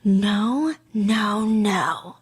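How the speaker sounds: imitating a stagey refusal with long pauses; mock-dramatic, spaced-out delivery; theatrical, oddly paused. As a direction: let each word hang as if for an audience. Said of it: "he takes a lot of pauses at really weird moments".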